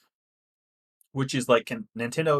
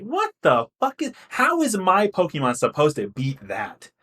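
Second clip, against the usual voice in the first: acted-out exasperation, higher pitch; mock outrage at unfair odds; indignant protest; 7 semitones higher, 3 dB louder than usual. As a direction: protest as if cheated in a match. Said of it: "I'm like".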